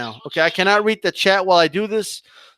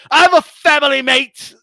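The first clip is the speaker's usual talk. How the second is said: mock quoting; 7 semitones higher, 5 dB louder than usual. Mocking retelling of Malik's excuse.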